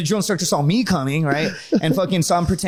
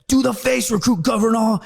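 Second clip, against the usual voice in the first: high, forceful mock military order; drill instructor; 6 semitones higher than usual. Drill instructor orders recruit to do the face, high mock bark. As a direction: imitate an instructor barking orders. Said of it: "just start screaming".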